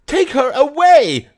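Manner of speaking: very high, loud slow shriek. A narrator's reading. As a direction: shriek at the bailiff. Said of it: "shrieked the old woman".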